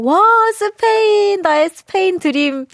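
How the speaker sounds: slow, high delighted gasp; dreamy excitement about Spain; excited. Real, not acted.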